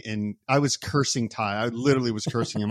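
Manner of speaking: ordinary talk, nothing marked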